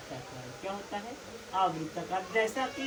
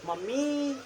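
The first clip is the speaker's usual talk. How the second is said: higher mummy with the end drawn out; calling from afar; 5 semitones higher, much slower than usual. Shown as a demonstration. Showing how to call a faraway mother, high and long. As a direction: call out to someone far off, stretching the last vowel. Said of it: "mummy आपसे बहुत दूर है".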